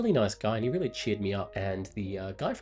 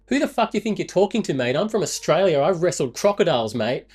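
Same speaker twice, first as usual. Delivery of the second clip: loud, higher-pitched mock challenge; retold yell; 8 semitones higher, 8 dB louder than usual. Traveller yelling at a Scottish guy from reception, loud boast. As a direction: yell it like a cocky challenge across a room. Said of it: "I yelled out at the Scottish guy".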